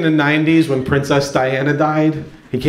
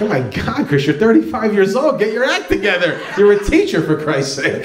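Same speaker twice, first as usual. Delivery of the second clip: exasperated scolding; 5 semitones higher than usual. Retold or imitated. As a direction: throw up your hands and scold him. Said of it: "It's like".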